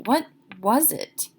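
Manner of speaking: usual speaking voice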